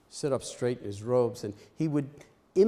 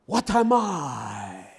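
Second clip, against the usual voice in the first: slow, louder drawn-out question; imitating the Zen master; 2 semitones higher, 7 dB louder, much slower than usual. Zen master to his students, slow and puzzled. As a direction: ask it slowly like a stern old master. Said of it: "So he would say".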